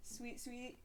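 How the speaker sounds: cooing. Real, not acted. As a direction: coo it softly like to a pet. Cooing over the toy.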